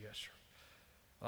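very low, hushed little answer; meek reply. Retold or imitated. Reluctant daughter answering her daddy, meek and hushed. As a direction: answer meekly, barely audible.

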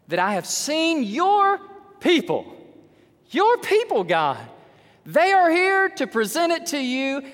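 loud, high, drawn-out pulpit cry; fervent scripture proclamation. Acted. Preacher voices David's joyful prayer over his people, fervently proclaiming. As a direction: proclaim each phrase slowly at full force.

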